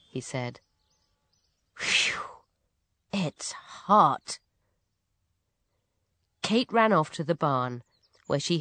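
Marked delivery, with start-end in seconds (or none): 1.8-4.4 hot and weary. A narrator's reading. Farmer to family after a hard morning's work, hot and weary. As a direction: puff out a relieved whew.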